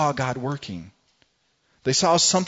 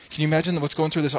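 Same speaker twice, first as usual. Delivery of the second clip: usual speaking voice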